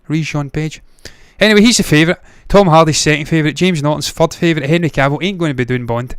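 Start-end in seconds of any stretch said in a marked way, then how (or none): none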